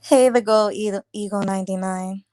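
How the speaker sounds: ordinary talk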